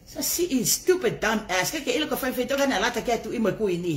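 ordinary delivery

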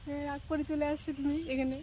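wife sweetly coaxing her sulking husband. Real, not acted. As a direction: plead gently to win him round.

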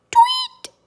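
very high, loud chirp; sudden squeaky bird noise; imitated chick tweet. A narrator's reading. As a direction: pipe it like a newly hatched chick. Imitates hatching chick in story.

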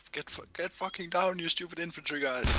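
high-pitched frantic yell. Retold or imitated. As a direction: bark it at the infantry. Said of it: "is busy yelling".

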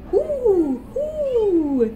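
high, round hooting; owl hoot. Pretend. Owl sound in picture book.

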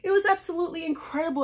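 ordinary delivery

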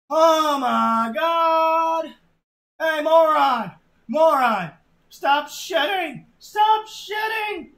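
high, loud, drawn-out panicked yelling; panicked scream. Acted. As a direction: scream at him to stop, rising to a frantic peak. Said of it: "and screamed".

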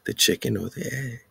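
ordinary delivery

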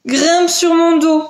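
high, slow fish voice; high, drawn-out invitation; helpful big fish. Acted. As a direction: offer a ride as a storybook fish. Big fish offers help.

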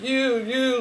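high, drawn-out mock cry. Imitating him in the dream, mock crying. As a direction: imitate a tearful wail on each word. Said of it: "it was just cries, bellows".

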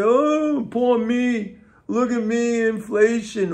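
high, whiny parody of a complainer; mock whining victim. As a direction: sneer at them like a self-pitying crybaby. Mocking viewers who complain.

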